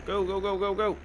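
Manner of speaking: urging it on. Real, not acted. Gamer willing the tractor through the snow, urging. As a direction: egg the machine on, pitch up.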